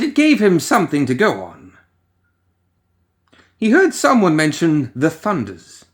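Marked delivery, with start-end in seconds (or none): none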